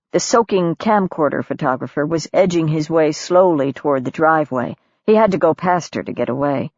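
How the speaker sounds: plain reading voice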